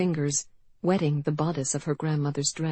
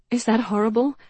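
put-on distraught young woman; 6 semitones higher, 4 dB louder than usual. A narrator's reading. Janey's anguished confession.

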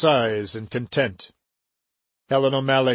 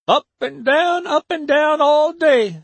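much higher, louder grumbling maid's voice; complaining old servant; 13 semitones higher, 4 dB louder than usual. A narrator's reading. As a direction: grumble like a worn-out housekeeper. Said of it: "she complained".

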